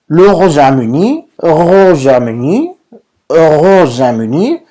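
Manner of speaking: "Royaume-Uni" muy despacio, subiendo al final; modelo lento. Shown as a demonstration. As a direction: alarga cada sílaba de "Royaume-Uni". Modela despacio la pronunciación francesa. (repite "Royaume-Uni" lento para la clase, con entonación ascendente)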